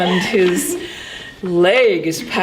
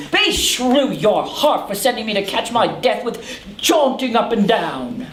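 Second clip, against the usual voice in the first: ordinary delivery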